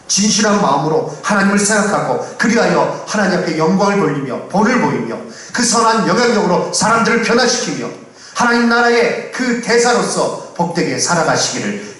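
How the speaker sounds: high, rousing pulpit exhortation; impassioned preaching. Real, not acted. Pastor exhorting believers to live as heaven's ambassadors, impassioned preaching. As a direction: build phrase on phrase, urging the congregation.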